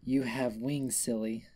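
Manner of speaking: ordinary delivery, nothing marked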